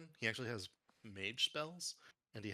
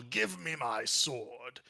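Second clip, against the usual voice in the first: half-raised, drawn-out character yell; mock yell; 4 dB louder, much slower than usual. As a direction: demand it slowly, as a restrained battle cry. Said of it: "He will yell".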